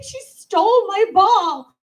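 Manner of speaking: put-on high, loud, slow whining child; whiny kid. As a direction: whine it like a tattling little sister. Sister complaining to dad.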